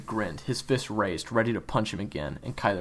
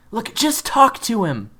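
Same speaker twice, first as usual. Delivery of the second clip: pleading; 13 semitones higher, 10 dB louder than usual. Acted. June urging Kyler gently.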